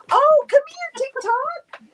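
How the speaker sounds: very high, bright cooing; high doting pet voice. Real, not acted. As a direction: coo to the little dog. Calling the pet in baby talk.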